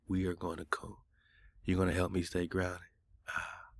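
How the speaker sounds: ordinary talk, nothing marked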